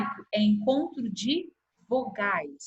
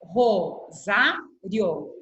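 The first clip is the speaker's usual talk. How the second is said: "Rosário" very slowly, Ro-sá-rio; syllable by syllable; 3 semitones lower, much slower than usual. Shown as a demonstration. Teacher dividing her surname "Rosário" for pupils, syllable by syllable. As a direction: split "Rosário" into three, keeping "rio" together. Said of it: "vou dividir em sílaba".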